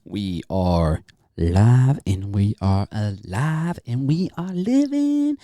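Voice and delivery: loud, slow showman announcement; hyped announcer. Acted. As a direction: belt it out like a ringmaster. Hyped show opening.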